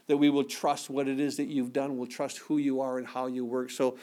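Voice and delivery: low, soft, unhurried prayer voice; congregational prayer. Acted. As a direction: pray quietly over the bread and cup. Closing prayer over communion.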